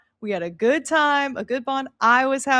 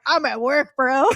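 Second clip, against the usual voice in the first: louder, casual, dude-like push on bro; bro attitude; 4 dB louder than usual. Retold or imitated. Imitating the server assistant's line now, mock bro attitude. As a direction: toss it off like a tired bro. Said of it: "But now I'm like".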